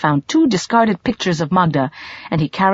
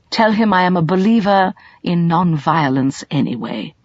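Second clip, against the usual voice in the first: usual speaking voice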